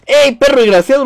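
loud, high shout at the enemy; angry yell. Real, not acted. Player yells at the enemy he shoots, loud and angry. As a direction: bark it out in fury.

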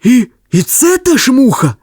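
high, stammering character voice; Ivan Ivanovich asking. A narrator's reading. Ivan Ivanovich asks the dog, high put-on voice. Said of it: "запитав Іван Іванович".

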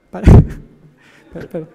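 stumbling through laughter; words breaking into giggles; laughing fit. Real, not acted. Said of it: "un ataque de risa". (ataque de risa)

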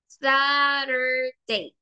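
Saturday said very slowly, every sound stretched; slow model word. Shown as a demonstration. Teacher models Saturday slowly to quiz the class. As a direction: spell out Saturday aloud by sound, unhurried. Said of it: "La pronunciación de la U es corta o es larga".

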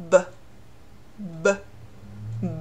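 B as a short voiced burst; isolated B sounds with the vocal cords buzzing; voiced consonant. Shown as a demonstration. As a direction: say B briefly with voice, spaced apart. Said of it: "就是浊辅音".

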